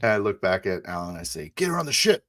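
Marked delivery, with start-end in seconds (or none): 1.6-2.2 high, raised command; called-out order. Acted. Pilot ordering Alan to load Isabel, called-out order. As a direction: call it back to a crewmate.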